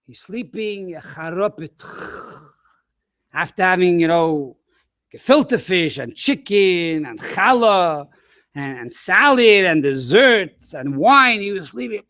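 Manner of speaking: ordinary delivery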